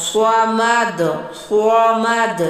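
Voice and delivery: صمد with a full heavy ص, not a thin س; heavy saad. Shown as a demonstration. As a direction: press the ص of صمد thick and round. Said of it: "اس طرح سے صاد کی آواز نکلے گی".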